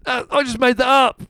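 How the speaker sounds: high-pitched mock confession; mimicking the co-host. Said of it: "You're gonna say".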